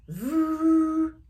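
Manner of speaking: high, loud, held engine roar; engine vroom. Acted. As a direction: sing out the machine kicking on. Machine starting up.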